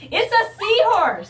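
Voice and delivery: high, loud, surprised glee; amazed at the seahorse toy; delighted surprise. Real, not acted. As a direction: squeal it out as you discover it.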